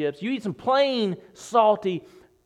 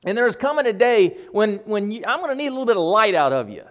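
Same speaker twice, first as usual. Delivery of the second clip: higher, louder, hedging mock version; wishy-washy mock Jesus; 4 semitones higher, 6 dB louder than usual. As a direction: lampoon a half-hearted Jesus. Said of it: "Now, Jesus doesn't say".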